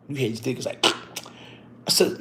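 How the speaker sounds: ordinary talk, nothing marked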